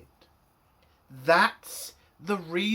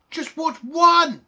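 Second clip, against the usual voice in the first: slow, loud, higher, each word punched; exasperated shout; 7 semitones higher, 8 dB louder, slower than usual. Real, not acted. Reviewer ordering the writers to watch an episode, slow furious shout. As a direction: plead in fury, word by word.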